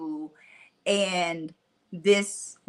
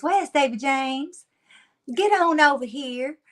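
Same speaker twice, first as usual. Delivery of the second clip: Mississippi twang; 5 semitones higher, 3 dB louder than usual. Retold or imitated. Caretaker calling a child over, thick Mississippi twang. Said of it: "she talks like this".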